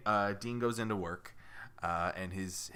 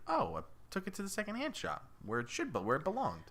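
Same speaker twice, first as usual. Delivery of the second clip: lightly voiced wife; 9 semitones higher, 4 dB quieter than usual. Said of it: "She's like".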